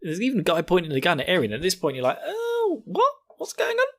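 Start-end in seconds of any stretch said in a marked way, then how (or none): 2.2-3.9 high-pitched puzzled squeak; mock bafflement. Baffled viewer at the gun on Eren, high mock bewilderment.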